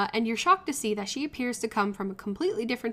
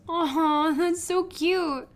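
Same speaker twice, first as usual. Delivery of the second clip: cooing; 6 semitones higher, much slower than usual. Acted. Cooing at photos.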